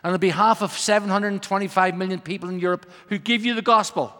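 usual speaking voice

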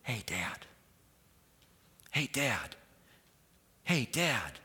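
hushed call to a sleeping dad; soft, secretive calling, first one barely voiced; retold whisper. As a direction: call out under your breath, half whispered. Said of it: "I'm doing it at a whisper".

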